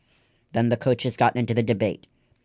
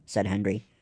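usual speaking voice

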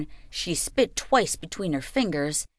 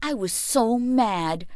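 ordinary delivery, nothing marked

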